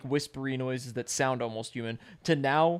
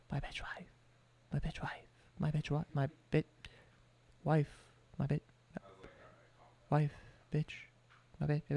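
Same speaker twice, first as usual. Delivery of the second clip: whispered, eerie repetition; creepy whisper; 9 dB quieter than usual. Retold or imitated. Streamer imitating monster birds, eerie whisper. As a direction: breathe it like a creature mimicking. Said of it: "the birds would be like".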